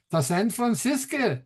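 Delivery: put-on a playful touch of Gene Wilder's Yiddish accent. Retelling a film rabbi's journey west, light Yiddish accent. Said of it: "in his best Yiddish accent".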